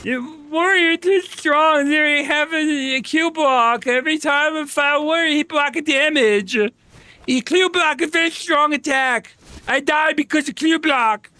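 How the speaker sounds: high, drawn-out mocking whine; mock community complainer. Roleplaying half the game's community griping about Warrior, mock complainer voice. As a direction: put on a high caricature of a griping gamer. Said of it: "I just roleplay it as half the B D O community".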